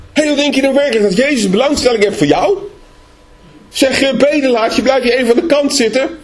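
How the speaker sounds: high, scornful talking down; condescending crowd. Acted. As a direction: look down on the beggar. Voicing the crowd rebuking Bartimaeus. (neerbuigend, hooghartig)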